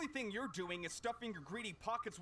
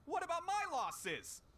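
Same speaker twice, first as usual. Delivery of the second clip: high, aggrieved protest; indignant demand; 8 semitones higher than usual. Acted. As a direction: demand it, fed up. Angry merchant demands compensation.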